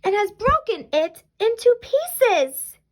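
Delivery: high, slow little-bear wail; drawn-out high cub complaint; wee little Baby Bear voice. A narrator's reading. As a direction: wail it slowly like an upset cub. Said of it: "in his wee little voice".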